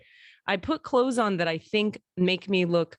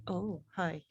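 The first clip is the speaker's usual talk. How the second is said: soft hello to dog; 11 dB quieter than usual. Real, not acted. Greets dog softly.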